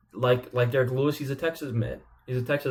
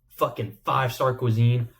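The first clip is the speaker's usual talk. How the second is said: ordinary talk, nothing marked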